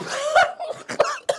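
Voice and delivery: high-pitched giggling burst; burst of laughter. Acted. Teacher illustrating "couldn't stop laughing", a high burst of laughter. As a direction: break into high, helpless-sounding giggles.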